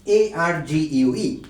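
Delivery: slow, letter-by-letter spelling. Shown as a demonstration. Teacher writing the word on the board, spelling out. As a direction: spell it slowly, each letter separate. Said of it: "আমি শব্দটি লিখছি বোর্ডে".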